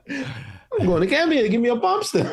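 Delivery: a bad British accent that breaks into laughter; playful failed English accent through giggles; attempted English accent. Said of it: "I can't do an English accent".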